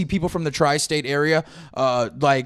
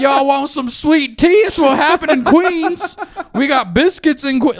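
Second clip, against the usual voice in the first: put-on full Southern accent; 9 semitones higher, 7 dB louder than usual. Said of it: "We do full South".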